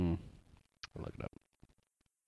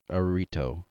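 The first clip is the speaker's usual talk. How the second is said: ordinary talk, nothing marked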